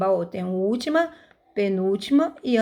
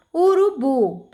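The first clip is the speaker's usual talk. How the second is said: Urubu raised, with a strong final BU; teacher's demo, Urubu stressed at the end; stressed last syllable; 4 semitones higher, 4 dB louder, slower than usual. Shown as a demonstration. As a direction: lean hard on the BU of Urubu. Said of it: "bu é a última sílaba".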